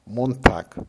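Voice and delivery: plain reading voice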